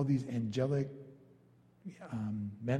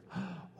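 mock gasp; 4 semitones higher, 11 dB quieter than usual. Mary remembering the lost son.

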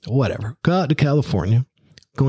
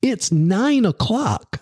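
irritated boss scolding; angry boss; 7 semitones higher, much slower than usual. Retold or imitated. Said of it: "he's livid".

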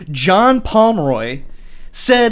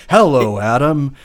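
put-on old dog voice; 7 semitones lower than usual. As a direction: speak low like a gentle old dog.

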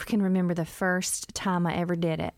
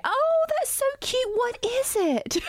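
gushing fake delight. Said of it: "I've learned to now just say".

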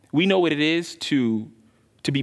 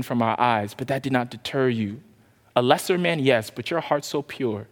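hushed prayer; 4 semitones lower than usual. Acted. Closing prayer after sermon.

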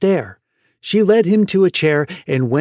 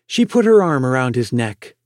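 usual speaking voice

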